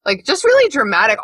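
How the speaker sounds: usual speaking voice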